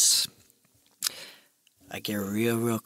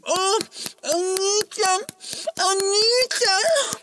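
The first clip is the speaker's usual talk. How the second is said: high moaning woman voice, hammed-up; much slower than usual. Comic storyteller voicing a moaning prostitute, high hammed-up moans. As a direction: moan it high and breathy-free like a mock lover.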